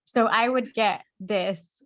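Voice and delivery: ordinary delivery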